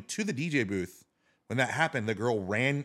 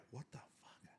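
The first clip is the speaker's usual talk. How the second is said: very soft whispered reaction; whispered disbelief; 4 semitones higher, 26 dB quieter, slower than usual. Retold or imitated. Storyteller replays feeling his arm get warm, whispered bafflement.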